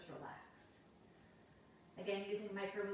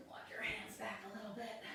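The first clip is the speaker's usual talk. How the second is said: whispering; 4 dB quieter than usual. Real, not acted. Yoga cue, whispered.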